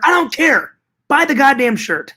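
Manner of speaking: high, hammed-up angry retort; comic high-pitched demand; mock fury. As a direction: cut off the victim with pretend rage. Shouting back at choked customer.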